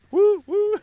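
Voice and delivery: very high, loud two-note hoot; cartoon truck toot. Shown as a demonstration. Streamer mocking own owl attempt, high cartoon toot. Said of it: "sounds like a cartoon truck or something".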